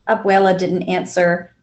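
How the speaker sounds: ordinary delivery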